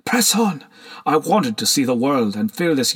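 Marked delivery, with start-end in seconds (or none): 0.0-0.6 high, insistent devil on the shoulder; whispered urging. A narrator's reading. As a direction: egg him on in a mock whisper.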